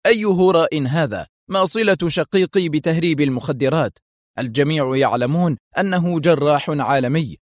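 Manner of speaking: high, indignant questioning; angry protest. A narrator's reading. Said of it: "مستطردا في غضب". (احتجاج غاضب بنبرة مرتفعة)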